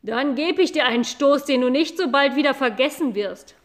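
loud threatening cow voice; angry cow threat. Acted. Cow threatens the boy, loud angry voice. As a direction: menace the boy with a butt of horns. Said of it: "sagte Mairos".

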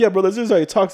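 high, loud mock explanation; imitated reassurance. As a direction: imitate a friend jumping in to explain. Imitating friend defusing the quarrel.

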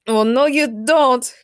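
slow, drawn-out loud shout. A narrator's reading. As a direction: yell it slowly after him. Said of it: "Colossus shouted".

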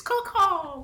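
high crow call; bird call. Acted. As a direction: caw it out high. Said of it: "that's terrible".

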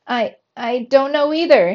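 ordinary delivery, nothing marked

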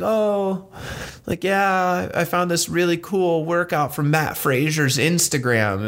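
imitating a client who is dodging the question; sheepish excuse. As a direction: client stalling a coach on a Zoom call, mock innocent. Client making excuses to coach.

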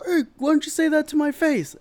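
very high, loud mocking tough guy; high loud caricature threat; mock angry Dodgers fan. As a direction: squeak out a fake threat.

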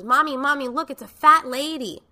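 put-on little girl calling out to mom, high and loud; loud, high-pitched mock kid voice; imitated child's yell. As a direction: mimic an excited young child shouting. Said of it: "She's screaming".

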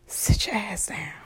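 whispering. Retold or imitated. God's stern quiet command.

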